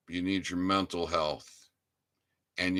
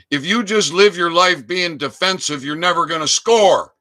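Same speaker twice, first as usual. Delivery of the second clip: rousing shout; 9 semitones higher, 10 dB louder than usual. Real, not acted. Rallying listeners to fight.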